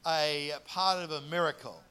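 normal talking voice

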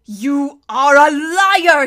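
slow, loud, venomous accusation; furious hiss. A narrator's reading. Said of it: "he hissed at Violet".

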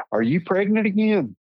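high-pitched teasing question from a friend; friend's surprised question. Retold or imitated. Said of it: "she said".

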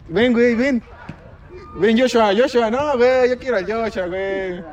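high, loud call to someone away; yelling across the room. Real, not acted. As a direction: shout for a friend to come over. Calling Joshua to come over.

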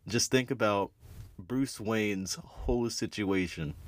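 normal talking voice

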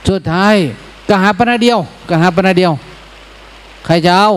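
high final auction call; auctioneer. Retold or imitated. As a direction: call the last price to the crowd. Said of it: "ตามหลักการประมูล".

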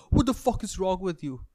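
furious rant at someone who loves him; angry yelling. Retold or imitated. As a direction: yell it, building to a scream. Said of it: "I just go".

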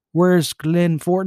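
ordinary talk, nothing marked

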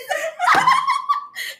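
high shrieking laugh. Real, not acted. Big laugh after joke.

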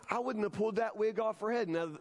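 normal talking voice

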